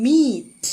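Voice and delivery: "meat" with a very long ee; prolonged vowel. Shown as a demonstration. Teacher explaining long vowels, "meat" stretched right out. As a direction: draw out the ee in "meat", slow and loud. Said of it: "in a prolonged manner".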